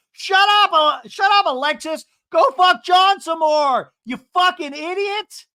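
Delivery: high, very loud parting tirade; hammed-up enraged yelling; imitated furious shouting. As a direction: scream it as a last shot on the way out. Emily's parting shot at the door.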